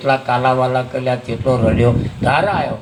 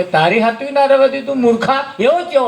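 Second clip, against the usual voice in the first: father's scolding; 9 semitones higher than usual. Retold or imitated. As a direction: scold him like a fool.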